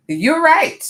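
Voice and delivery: high, loud excited "you're right"; squealed "you're right"; excited agreement. Real, not acted. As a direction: cheer the chat's good idea.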